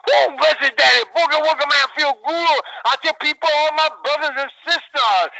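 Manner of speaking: high, loud, fast-rolling wrestling promo; wide-open promo voice. Shown as a demonstration. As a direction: smoke it out, high-energy hype. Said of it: "this is how I talk".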